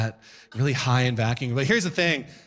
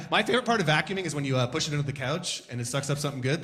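ordinary talk

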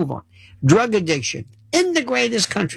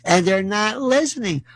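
ordinary talk, nothing marked

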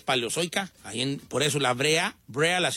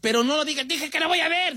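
mock protest; 11 semitones higher, 3 dB louder than usual. Coach mimics host's protest.

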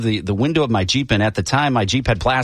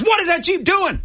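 imitated cop, far higher and sharper; angrier cop repeat. Cop repeats his question to the driver, mock exasperated and very high. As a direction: push it up, losing patience. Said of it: "having a really bad day".

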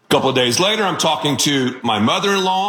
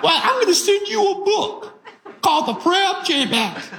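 put-on Southern mother-in-law accent. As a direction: do the mother-in-law's thick Southern accent, pitched way up. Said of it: "She has a one-of-a-kind Southern accent".

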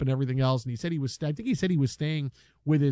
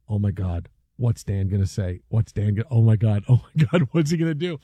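low panicked muttering; 8 semitones lower than usual. Retold or imitated. Panicked thought retold.

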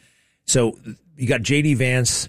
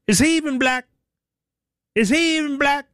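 much higher put-on voice, mocking Obama; Obama's put-on voice; 14 semitones higher, faster than usual. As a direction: squeak it up high like a mocking impression. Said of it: "I say it in that voice".

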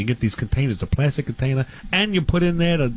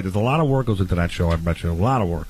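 low mock aside; 11 semitones lower than usual.

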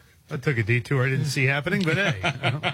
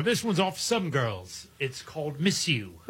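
[name]; 5 dB quieter, slower than usual. Retold or imitated. Host imitating the [name] stage patter he kept expecting, rock-star impression. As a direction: introduce a song with a drawn-out, softer delivery. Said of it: "It sounds like [name]".

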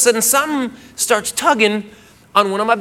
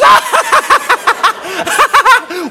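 high, loud howling laugh; high hysterical laugh; 12 semitones higher, 4 dB louder than usual. Retold or imitated. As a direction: laugh until she can't stop. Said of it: "she goes".

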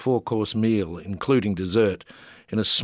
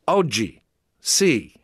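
loud, raised, clear Oggi sì; said loudly; 4 semitones higher, 4 dB louder, much slower than usual. A narrator's reading. Teacher jumping to show a word, loud and clear. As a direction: call it out like a teacher drilling a word. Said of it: "she said loudly".

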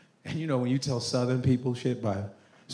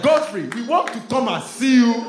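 put-on high, loud Nigerian-accented plea; hammed-up Nigerian accent, pitched high; thick Nigerian accent; 9 semitones higher, 6 dB louder than usual. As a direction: imitate an eager Nigerian cousin. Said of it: "Not with that accent".

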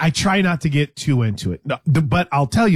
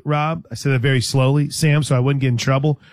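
ordinary talk

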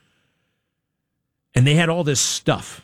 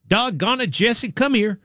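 mild, unbothered call to a dog, pitched higher; calm, benign; 9 semitones higher than usual. Retold or imitated. Father calls the dog Jesse, calm and benign. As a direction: imitate a gentle, innocent-sounding dad calling the dog. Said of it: "like, kinda calm and, like, benign".

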